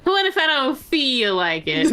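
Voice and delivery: high whiny question through laughter; laughing whine. Retold or imitated. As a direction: giggle while sulking. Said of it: "Spotify said".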